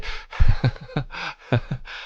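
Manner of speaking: soft, breath-only chuckling; quiet laughter. Real, not acted. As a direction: wheeze with amusement, no voice. Amused by the text-to-speech voice.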